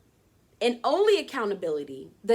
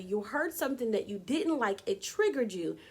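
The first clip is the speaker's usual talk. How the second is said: ordinary delivery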